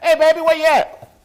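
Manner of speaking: loud, high holler to someone in another room; calling out. Retold or imitated. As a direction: call out across the apartment. Calling to partner through apartment.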